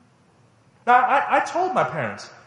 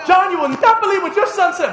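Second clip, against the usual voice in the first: much higher and louder, stress on not; indignant tattling mother; 13 semitones higher, 8 dB louder than usual. Retold or imitated. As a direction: tattle to the husband, scandalized. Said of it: "Dad gets home".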